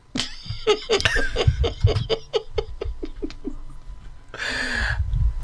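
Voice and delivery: long breathless high laugh; helpless silent wheeze of laughter; wheezing laugh. Real, not acted. Laughing at caller's joke.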